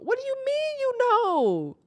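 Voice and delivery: high, stunned disbelief; incredulous outburst. Real, not acted. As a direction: throw the question back in shock. Outraged at friend's confession.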